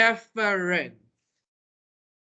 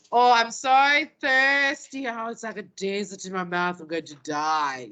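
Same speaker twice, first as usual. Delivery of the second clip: put-on dramatic teenager. Teacher mimicking exaggerating teenagers, dramatic mock suffering. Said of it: "we love to be dramatic".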